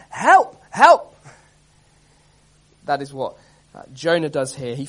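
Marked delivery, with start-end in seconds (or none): 0.1-1.1 loud, high-pitched shouts for rescue; crying for help. Acted. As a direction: yell to a distant rescue boat. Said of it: "she'd just be crying out".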